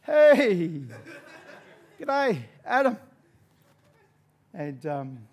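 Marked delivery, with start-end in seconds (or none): 0.0-3.0 loud, high, over-friendly hello; hammed-up greeting. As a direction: greet with exaggerated cheer. Acted greeting at the reunion.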